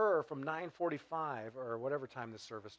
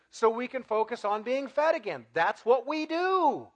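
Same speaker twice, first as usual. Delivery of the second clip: louder preaching, higher at the peaks; full-force preaching; 6 semitones higher, 10 dB louder than usual. Acted. Pastor warning the congregation off complacency, louder. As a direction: push it hard and rising.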